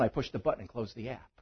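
usual speaking voice

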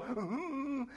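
quiet whimpering hum; small mock-scared moan; cowardly lion whimper. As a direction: imitate a frightened lion's whimper. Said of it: "he's got his tail in his hand going".

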